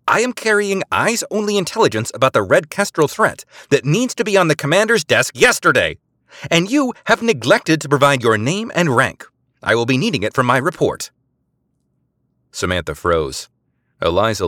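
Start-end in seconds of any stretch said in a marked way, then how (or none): 0.1-11.1 high, loud official demand; stern demand. A narrator's reading. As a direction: demand his name coldly. Eliza demanding name and rank.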